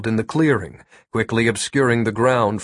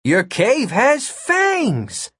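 high, very slow proud announcement; 11 semitones higher, much slower than usual. Acted. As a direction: show it off proudly, drawn out. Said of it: "in a proud voice".

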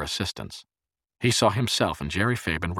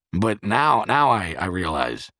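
stammering self-justification, a bit louder; yammering; 3 semitones higher, 6 dB louder, slower than usual. A narrator's reading. Freck justifying his own failure, yammering on. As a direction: babble on with repeated false starts. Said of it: "he yammered on".

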